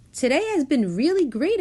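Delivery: high, quick happy agreement. A narrator's reading. Jonah agrees about the lovely day, high and quick. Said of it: "agreed Jonah".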